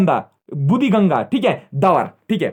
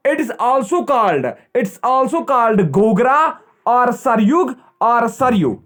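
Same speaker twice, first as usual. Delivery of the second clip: slow dictation; 5 semitones higher, 3 dB louder, much slower than usual. Shown as a demonstration. Teacher dictating names for notes.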